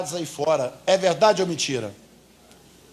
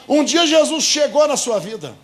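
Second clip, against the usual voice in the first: normal talking voice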